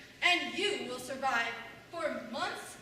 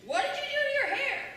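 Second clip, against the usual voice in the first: shocked yell; 10 semitones higher, 8 dB louder, faster than usual. Retold or imitated. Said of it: "Instead, I say".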